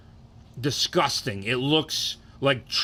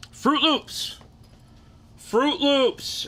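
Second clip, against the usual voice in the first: much higher, hyped-up call of the name; excited announcement; 13 semitones higher, much slower than usual. Real, not acted. Ranking cereals for viewers, he calls the next one out with high excitement. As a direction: sing out the cereal name like a game show reveal.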